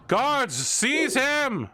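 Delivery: high, slow roared command; roaring tyrant king. Acted. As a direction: bellow the order to the guards. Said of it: "the king is just like".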